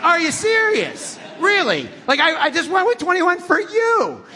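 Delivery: high, loud, incredulous; exasperated disbelief. Retold or imitated. Preacher retorts to the stranger in loud disbelief. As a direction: throw up your hands verbally.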